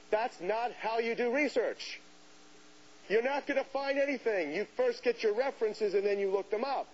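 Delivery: mock scolding, pitched high; imitated yelling. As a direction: act out an exasperated librarian's telling-off. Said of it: "he starts yelling at me".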